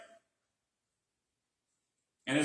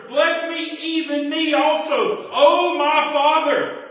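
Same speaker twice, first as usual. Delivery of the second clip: much higher, louder and slow, anguished plea; bitter cry; 7 semitones higher, 7 dB louder, slower than usual. Acted. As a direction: beg your father through bitter crying. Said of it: "He cried with a great and exceeding bitter cry".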